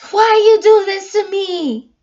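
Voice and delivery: put-on high, loud whiny child's complaint; angry little girl. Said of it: "she was so angry ... she's just like".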